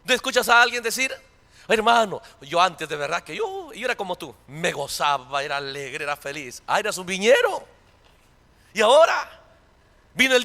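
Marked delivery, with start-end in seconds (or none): 1.7-6.6 mock mournful believer. Preacher imitating a once-joyful brother, low and sad. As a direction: lower and softer, fading lament.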